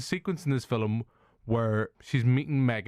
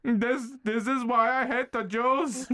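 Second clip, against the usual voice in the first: mock Hitler; 13 semitones higher, slower than usual. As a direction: ham up a cartoonish dictator. Mocking Hitler voice.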